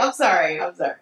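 normal talking voice

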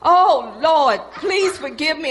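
loud repentant plea; loud pleading. Retold or imitated. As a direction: cry out for forgiveness.

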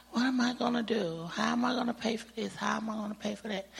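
fast worried muttering; anxious rush. Retold or imitated. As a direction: rattle off the worries quickly. Her worried thoughts, retold.

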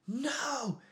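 very high, shrieky mock kid voice; imitated child screaming. Dad imitates son screaming at him over the belt, high-pitched scream. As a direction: scream back like a defiant little boy. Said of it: "He screamed at me".

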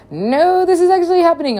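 high, loud alien correction; insistent alien. Retold or imitated.

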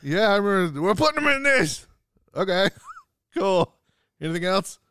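high, animated quoted voice; acting out a casual chat; acted exchange.

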